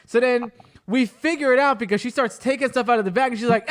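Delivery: ordinary delivery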